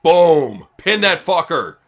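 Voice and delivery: rising hype over the big move; excited play-by-play. Acted. Streamer hyping Cena's top rope move, excited call. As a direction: call each beat of the move.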